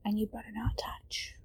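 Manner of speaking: soft sleepy whisper; pillow talk. Acted. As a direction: whisper goodnight close to her ear. Goodnight whispers to partner.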